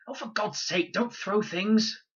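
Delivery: put-on high, loud girl's voice, slow on the last words; a girl's irritated shout from the bushes; exasperated girl. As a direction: scold him for throwing things. Girl annoyed at thrown rock.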